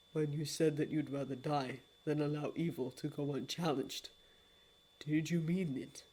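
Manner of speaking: low, soft, solemn regret; a low, hushed apology; grave lord of dreams. Acted. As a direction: speak like a deep and remorseful old god.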